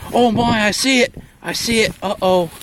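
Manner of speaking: loud and high, thrilled; excited spotting. Pretend. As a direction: yelp it like you spotted a beast. Spotting the specimen in parody.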